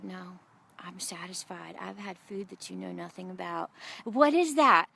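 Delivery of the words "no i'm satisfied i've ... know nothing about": a near-whispered quiet assurance; hushed contentment. Retold or imitated. Jesus telling his disciples he is satisfied, hushed contentment.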